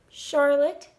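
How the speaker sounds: ordinary delivery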